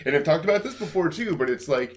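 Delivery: ordinary talk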